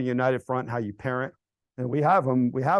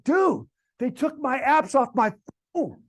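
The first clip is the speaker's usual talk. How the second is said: put-on higher, slower teen complaint, lightly whined; mock whiny teen; 11 semitones higher, 4 dB louder, slower than usual. Teen at the gym whining to his buddy, mock whine. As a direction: imitate a sulky teenager, stretched out.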